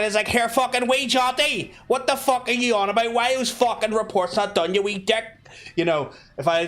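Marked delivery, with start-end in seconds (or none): 0.3-5.2 high, hammed-up broad Belfast; proper Belfast accent. Shown as a demonstration. Worker chewing out a colleague over reports, thick Belfast accent. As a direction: lay on thick Belfast, pitched well up. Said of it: "talking like a fucking proper fucking Belfast".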